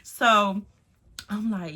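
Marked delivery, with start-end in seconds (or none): none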